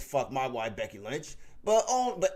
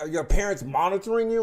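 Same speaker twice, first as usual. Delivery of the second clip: normal talking voice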